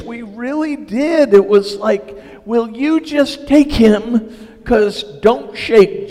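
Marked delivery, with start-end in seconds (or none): 2.4-6.1 loud, high, slow begging; acted-out frazzled urgency; desperate plea. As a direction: plead slowly like a parent near breaking. Acts out desperate handing off baby.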